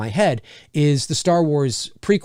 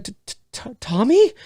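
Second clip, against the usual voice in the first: mock stammer; 6 semitones higher than usual. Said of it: "And she's like".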